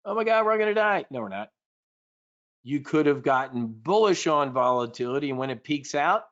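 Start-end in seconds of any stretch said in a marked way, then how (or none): 0.0-1.1 high, fast hammed-up doom cry; mock panic. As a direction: rattle off mock terror. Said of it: "This is free money on your screen".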